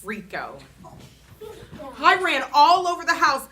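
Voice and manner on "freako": ordinary delivery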